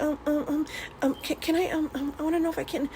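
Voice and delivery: put-on high, soft, stammering little kid; nervous stuttering. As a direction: stammer it out scared of dad. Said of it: "like that. That's how I used to talk to my dad".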